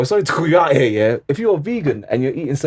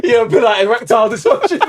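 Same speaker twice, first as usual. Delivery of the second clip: laughing; 7 semitones higher, 4 dB louder than usual. Real, not acted. Laughing teasing.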